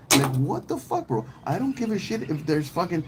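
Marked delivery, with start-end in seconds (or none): none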